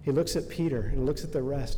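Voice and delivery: usual speaking voice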